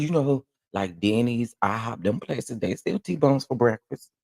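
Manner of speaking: ordinary delivery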